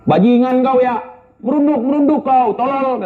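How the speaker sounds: makian komandan ditirukan, lebih tinggi dan berbeda warna; bentakan komandan. Retold or imitated. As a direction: tirukan komandan membentak prajurit saat latihan. (komandan memaki anak buah yang merunduk, bentakan tiruan)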